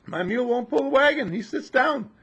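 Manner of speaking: high, loud farmer's complaint; complaining farmer. Acted. Farmer complaining to neighbour about his mule, high and loud. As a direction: voice the fed-up farmer. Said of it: "the other farmer came along and said".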